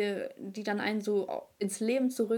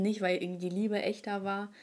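usual speaking voice

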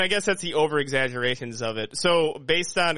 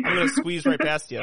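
higher, louder hammed-up Canadian politeness; mock Canadian accent; 12 semitones higher, 4 dB louder than usual. Friend mocks Canadians squeezing past, higher louder Canadian accent.

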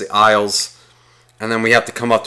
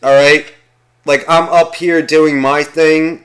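higher-pitched mock priest giving orders; imitated priest, raised voice; imagined priest; 4 semitones higher, 4 dB louder than usual. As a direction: play a self-important priest instructing from the altar. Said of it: "like, the priest being like".

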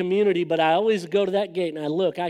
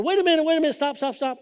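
higher, urgent call to halt; urgent stop; 7 semitones higher, faster than usual. Retold or imitated. Young man urgently stopping friends' car, raised pitch. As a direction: stop them before they drive off.

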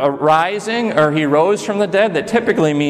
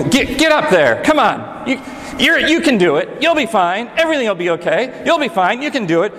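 put-on higher, insistent urging of a scared toddler; pushy coaxing parent; 7 semitones higher than usual. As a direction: cheer the kid on while nagging them into the pool. Parent pushing scared child into pool.

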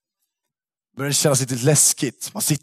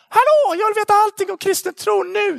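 mock eager Klara. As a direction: squeal it like an overeager convert. Imagined Klara eager at school.

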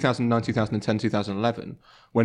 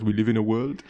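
a hint of Wenger at a press conference; Arsene Wenger voice. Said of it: "He goes".

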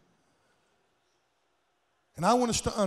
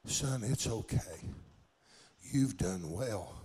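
soft, slow, hushed words of comfort; frail mother soothing; 7 semitones lower, 6 dB quieter, slower than usual. Retold or imitated. Old mother comforting her weeping son, soft and slow. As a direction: pat him with your voice, slowly.

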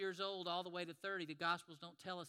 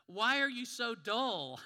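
slow, louder, teasing rebuke; mock exasperation; 4 semitones higher, 11 dB louder, slower than usual. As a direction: scold them playfully as Jesus. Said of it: "Jesus will answer them with things like this".

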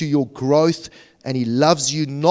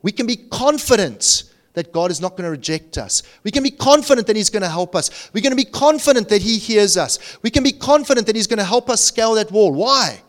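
high, loud, fast-building sermon delivery; fired-up preacher, louder and higher; impassioned preaching; 6 semitones higher, 4 dB louder, faster than usual. Real, not acted. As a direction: drive each repeated phrase home with rising force. Preaches at full force.

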